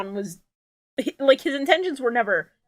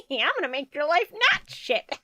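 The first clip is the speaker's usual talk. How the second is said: lightly voiced old fairy godmother, a bit hushed; a hint of a crone granting wishes; 1 old lady witch; 3 semitones higher, 3 dB quieter than usual. Mocking the fairy godmother as a mild old crone voice. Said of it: "lady on a broomstick who's like".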